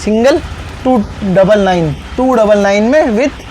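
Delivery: high, eager sales pitch; overeager teleshopping pitch. Acted. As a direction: pitch the bargains like a TV seller. Seller pitching deals, voice raised.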